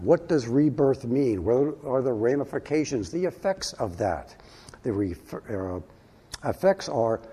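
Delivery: ordinary delivery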